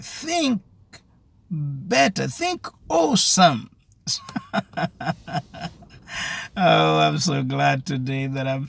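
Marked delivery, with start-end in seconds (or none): 0.0-3.7 high-pitched, emphatic urging; rousing exhortation. Real, not acted. 4.1-5.7 real laugh bubbling out; sustained, pleased chuckle; delighted laugh. Real, not acted. Delighted laugh after exhortation.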